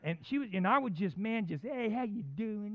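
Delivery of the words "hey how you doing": slow, stretched-out mock hello; over-friendly greeting. Preacher imitates himself greeting the grumpy bookstore lady, over-friendly. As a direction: draw the greeting out playfully.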